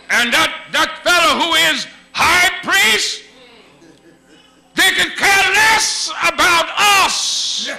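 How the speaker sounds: loud, high, building sermon shout; full-force preaching. Acted. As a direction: preach it hard, rising to a cry.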